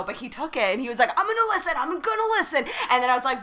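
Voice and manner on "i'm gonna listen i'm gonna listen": high-pitched mimicked insistence; eager promise. Actor taking the podcast card, mimicked eager promise.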